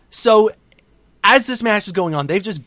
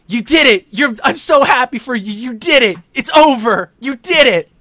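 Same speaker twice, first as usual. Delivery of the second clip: loud, higher-pitched congratulations; acted jubilation; 5 semitones higher, 6 dB louder than usual. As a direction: cheer him on as his overjoyed wife. Acting wife celebrating with her husband.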